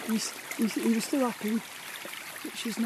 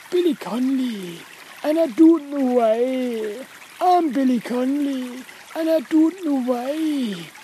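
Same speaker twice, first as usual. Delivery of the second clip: slow, drawn-out Scottish comic impression; hammed-up Scottish celebrity impression; Billy Connolly; 3 dB louder, slower than usual. Shown as a demonstration. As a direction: stretch it out like a Scottish comedian.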